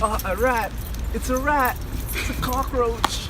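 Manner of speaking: high-pitched mock alarm; playful fake panic; mock fright. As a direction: squeal it as if startled by a rat.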